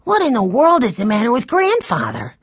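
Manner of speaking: put-on high-pitched child's bewilderment; puzzled young boy. A narrator's reading. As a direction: wonder like a baffled little boy. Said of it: "thought Benny".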